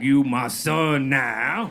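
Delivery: hammed-up slow blessing from a new father; stepdad's solemn reply. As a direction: drag it out like a deadpan vow from a proud stepdad. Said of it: "And he was like".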